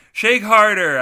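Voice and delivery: high, very loud command; shouted order. A narrator's reading. Narrator voices a shouted order.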